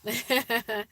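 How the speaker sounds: short amused laugh; light laugh; chuckle. Real, not acted. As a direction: let out a brief chuckle. Chuckles at own remark.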